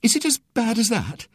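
high-pitched, fearful question; faint, worried. A narrator's reading. Mother fearing the worst from the doctor, faint. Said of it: "said Missus Brown faintly".

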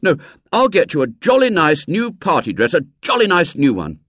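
boastful with a laugh; a boy showing off; laughing brag. A narrator's reading. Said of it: "William gave another short laugh".

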